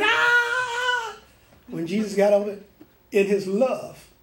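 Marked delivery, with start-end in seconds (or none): 0.0-1.2 much higher mock scream of pain; imitated scream. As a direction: cry out like the whipped man. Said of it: "He was screaming".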